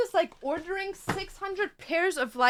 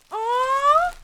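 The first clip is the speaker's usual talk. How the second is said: delighted aw; 10 semitones higher, 8 dB louder than usual. Real, not acted. Opening a cute parcel.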